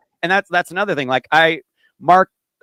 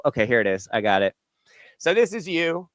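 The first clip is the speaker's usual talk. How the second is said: normal talking voice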